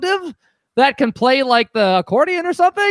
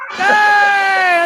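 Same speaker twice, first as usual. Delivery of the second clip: excited cheer; 8 semitones higher, 5 dB louder than usual. Real, not acted. Cheering correct answer.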